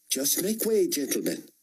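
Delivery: Hannah's put-on officer accent, clipped command; crisp put-on accent giving an order; practiced officer accent. A narrator's reading. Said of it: "again with her practiced accent".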